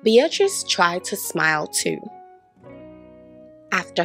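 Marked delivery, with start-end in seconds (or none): none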